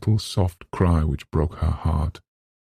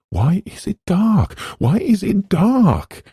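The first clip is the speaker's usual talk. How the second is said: high frightened cry, much higher and louder; panicked scream; 5 dB louder than usual. Acted. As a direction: shriek it in the dark, terrified. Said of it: "thought screamingly".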